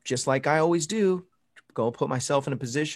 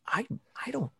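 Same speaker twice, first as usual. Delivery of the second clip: shocked whisper; 12 semitones higher, 7 dB quieter than usual. Retold or imitated. Shocked reply to church lady.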